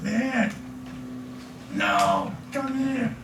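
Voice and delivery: lightly acted devil calling someone over; mock tempter. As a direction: coax them back in a soft voice. Acts out Satan luring someone.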